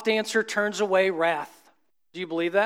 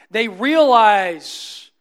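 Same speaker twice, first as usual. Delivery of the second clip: drawn-out emphasis; 6 dB louder, much slower than usual. Real, not acted.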